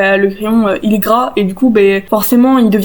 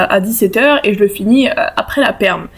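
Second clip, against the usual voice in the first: ordinary delivery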